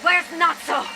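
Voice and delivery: high, urgent questions; alarmed waking captive. Acted. Captive waking among unknown interrogators, alarmed demand. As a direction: demand answers on waking in a panic.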